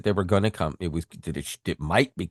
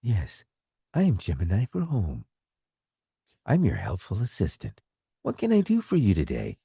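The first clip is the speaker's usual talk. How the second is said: imitating the chosen Gemini voice; 3 semitones higher than usual. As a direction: play the cheerful home assistant, just lightly. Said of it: "the voice I ended up picking is".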